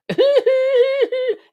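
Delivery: very high mocking laugh. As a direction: cackle high and loud, mocking him. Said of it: "He's got the worst laugh as well".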